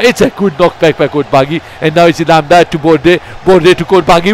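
ordinary delivery

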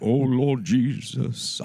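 slow, loud, quavering holy-voice prayer; churchy vibrato prayer parody; mock preacher prayer. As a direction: ham up a pompous preacher praying. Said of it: "I don't know why they put vibrato".

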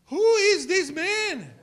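high, loud, slow astonished cry; hammed-up fearful amazement; acted terrified. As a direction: exclaim it in frightened awe.

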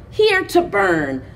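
ordinary delivery

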